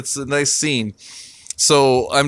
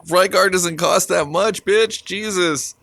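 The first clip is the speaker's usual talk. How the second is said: appalled complaint; 6 semitones higher than usual. Retold or imitated. Complains about the dancer's body odour.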